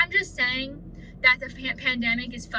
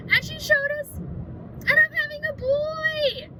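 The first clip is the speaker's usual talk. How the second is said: excited squeal; slower than usual. Real, not acted.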